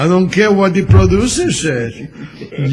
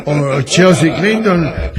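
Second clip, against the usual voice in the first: ordinary talk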